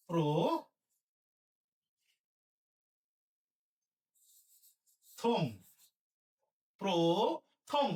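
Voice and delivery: প্রথম split into pro... thom; syllable-by-syllable demo. Shown as a demonstration. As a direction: dictate প্রথম slowly, pro and thom apart. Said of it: "প্রথমে হবে কি?". (প্রথম ভেঙে প্রো-থম)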